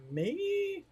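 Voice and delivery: squeaky high surprised "Me?". Real, not acted. Viewer's comment surprises the streamer, who squeaks in surprise. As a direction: caught off guard, voice jumps up.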